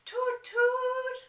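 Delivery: high loud sung toot like a train whistle. A narrator's reading. Storyteller imitating the four o'clock train whistle, high sung toot.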